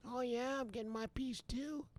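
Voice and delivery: tiny, high, soft mocking voice; very high, hushed parroting; mocking imitation. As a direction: squeak it back quietly like a taunt. Mimics the other side, tiny high voice.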